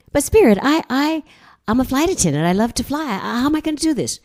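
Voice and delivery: high, pleading protest; pleading to Spirit that she's only a flight attendant; anxious protest. Retold or imitated. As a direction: plead your case, flustered and rushed. Her own protest to Spirit.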